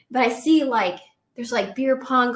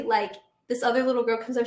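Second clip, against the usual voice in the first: ordinary delivery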